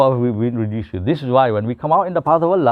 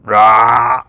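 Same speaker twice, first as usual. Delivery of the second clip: mock burp; 5 dB louder than usual. Imitating a post-meal burp.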